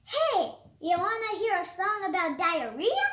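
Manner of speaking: ordinary delivery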